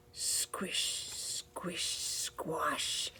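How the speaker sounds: whisper. A narrator's reading. Spooky knocking narration.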